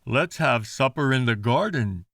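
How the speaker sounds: cheerful dad suggesting; father proposing supper outside; father's voice. Acted. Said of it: "Mister Thomas said".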